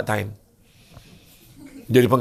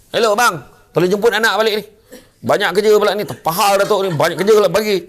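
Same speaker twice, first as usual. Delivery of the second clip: irritated call; 8 semitones higher, 6 dB louder than usual. Acted. Isteri menelefon suami dengan marah.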